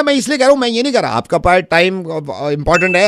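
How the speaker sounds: ordinary delivery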